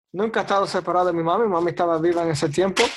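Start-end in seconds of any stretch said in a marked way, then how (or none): none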